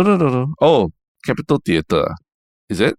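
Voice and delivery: ordinary talk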